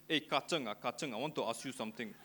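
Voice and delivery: lightly imitated Chinese-Malaysian accent. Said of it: "a lot of my Chinese friends".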